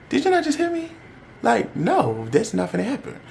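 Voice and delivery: high-pitched, disbelieving retort; incredulous refusal. Retold or imitated. Telling him she already refused, retold in high-pitched disbelief. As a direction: push it up high, acting out disbelief.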